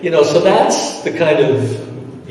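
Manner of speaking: ordinary talk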